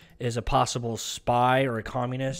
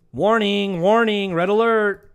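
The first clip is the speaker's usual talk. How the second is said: higher, much louder alarm-siren call of "Warning"; mock alarm; 6 semitones higher, 8 dB louder, slower than usual. Vlogger sounding an alarm over the uranium deal, loud mock siren call. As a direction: blare it like a ship's alarm.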